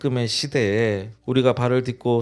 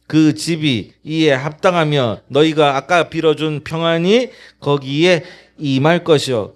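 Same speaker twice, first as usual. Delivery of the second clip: loud, measured congregational scripture reading; scripture read aloud together; 6 dB louder than usual. Real, not acted. Pastor leads the congregation in reading scripture, loud and slow. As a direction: read the verse slowly and loudly with the church. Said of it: "같이 읽어봅시다".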